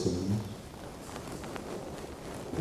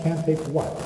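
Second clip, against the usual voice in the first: ordinary talk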